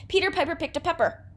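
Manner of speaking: Peter Piper picked a pepper rattled off quickly; fast tongue twister. Shown as a demonstration. Teacher speeding through a twister for students, fast. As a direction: fire off the P words fast. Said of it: "See if you could do that one".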